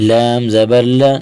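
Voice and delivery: ordinary talk, nothing marked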